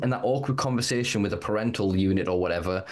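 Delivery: usual speaking voice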